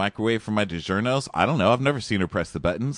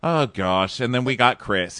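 ordinary talk

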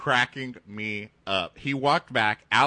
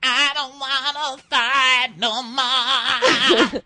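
much higher, louder, slow, hammed-up plea; mock Tina; 8 dB louder, slower than usual. Quoting Tina's line as a dramatic plea. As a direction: cry it like a movie victim.

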